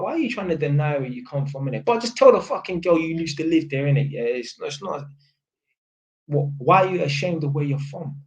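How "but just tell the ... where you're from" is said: ordinary talk, nothing marked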